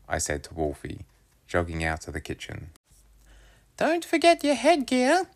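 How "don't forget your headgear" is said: much higher mum voice, called out; mum calling after. A narrator's reading. Mum calling after her son, much higher voice. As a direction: remind him as he leaves. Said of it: "Mum's voice followed me out".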